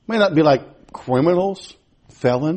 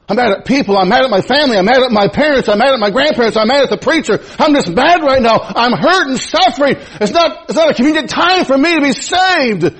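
mock angry excuse; 10 semitones higher, 5 dB louder than usual. Voicing an offended person's excuse.

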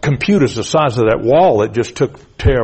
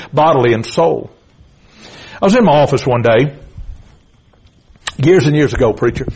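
usual speaking voice

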